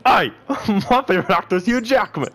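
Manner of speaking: high, quick, silly Australian voice; mock Australian accent. Friend trying to get a laugh, in mock Australian accent. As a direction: race through a hammed-up Australian accent. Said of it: "talk like them".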